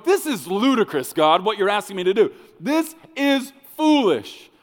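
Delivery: loud, high-pitched exasperated protest; hammed-up indignant outburst; acted frustration. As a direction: complain loudly, slowing to hammer the last words. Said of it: "Instead of getting frustrated and going".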